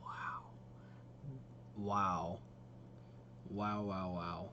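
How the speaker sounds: low, softly stunned wows; hushed awe. Real, not acted. Streamer reacting to a stunning character trailer, hushed awe. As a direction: let each wow drop low, in quiet awe.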